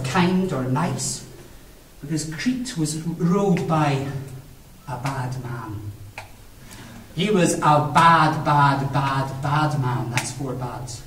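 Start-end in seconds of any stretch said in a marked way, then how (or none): 4.9-10.1 low, slow, soft stress on bad; ominous emphasis. A narrator's reading. Narrator warning children about the king, low and slow. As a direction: lean darkly on each bad.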